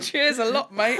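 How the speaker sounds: laughing mock Cockney thanks; chirpy put-on Cockney, laughing; imitating fake Alfred's Cockney, laughing. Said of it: "that's what Alfred is".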